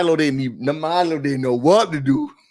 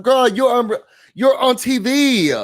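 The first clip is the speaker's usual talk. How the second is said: sassy retort; 8 semitones higher than usual. Real, not acted. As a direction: spell it out to her, high and pointed. Exasperated retort.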